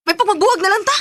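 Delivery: loud high furious outburst; angry breakup. Acted. Pregnant woman breaking up with boyfriend, shouting furiously. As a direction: throw the breakup at him.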